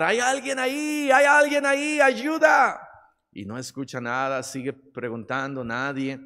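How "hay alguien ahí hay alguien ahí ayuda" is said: high-pitched cry for help, drawn from a story; acted shouting for help. Young man hanging from a branch calls out, shouting for help. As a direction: shout up high as if calling for a rescuer. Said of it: "empieza a gritar".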